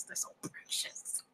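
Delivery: soft, slow breathy whisper. Real, not acted. Reader gushing over the children, whispering slowly. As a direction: hush it like a tender secret.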